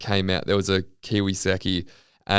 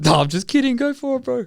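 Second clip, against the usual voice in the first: put-on high, friendly Kiwi accent; sweet Kiwi; 5 dB louder than usual. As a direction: imitate a cheery New Zealander letting someone off. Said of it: "He was the sweetest Kiwi guy".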